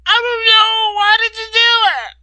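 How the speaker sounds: high, loud sobbing demand; crying shout. Acted. Katie to Ryder, crying shout. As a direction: cry it out at him.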